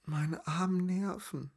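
slow, high, breathy whimper; whispered anxious lament. A narrator's reading. Hiding woman laments her nerves, high feeble whimper. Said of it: "flüsterte Lazaralen".

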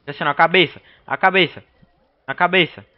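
loud urgent calls to aim for the head; shouting. Real, not acted. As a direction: yell it out mid-fight. Gamer shouting aim instructions.

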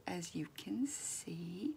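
soft unvoiced aside; whisper. Real, not acted. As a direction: whisper it slowly. Aside while sculpting ear.

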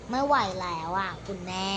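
quiet whine. Real, not acted. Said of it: "ถ้ามึงไม่ได้ยินเสียงกู".

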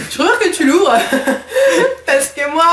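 laughing while asking; giggling through the words. Real, not acted.

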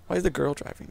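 usual speaking voice

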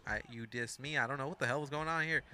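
normal talking voice

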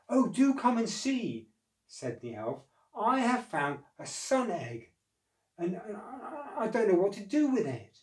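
light high elf voice, eager; a small elf bursting with news; excited little elf. Acted.

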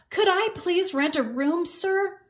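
put-on high-pitched, polite young cat; polite request. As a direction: ask courteously, pitched well up. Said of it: "Nicholas asked politely".

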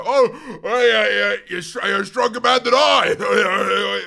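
big booming mock-impressed reaction; mock admiration. Drinkers reacting to two years without alcohol, mock admiring.

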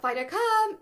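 high, loud call of the dog; recall shout. Shown as a demonstration. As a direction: shout the recall cue brightly. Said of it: "when you shout".

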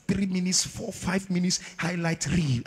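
ordinary talk, nothing marked

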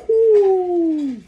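high, loud delighted ooh; a sudden thrilled squeal at the card; 1 excited ooh. Real, not acted. Pulling a hit card.

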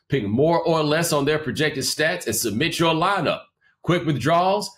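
upbeat, even sponsor read. Acted. Podcast host reads a sports app ad, upbeat.

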